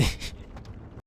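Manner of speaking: breathy voiceless laugh; stifled, airy giggle. Real, not acted. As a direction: crack up quietly mid-sentence. Cracks up at game scare.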